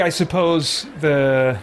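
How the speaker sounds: ordinary talk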